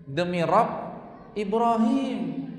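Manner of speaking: mock angry oath. Aisyah swearing crossly at the Prophet. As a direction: draw out Ibrahim with a huff. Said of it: "Tapi kalau lagi marah".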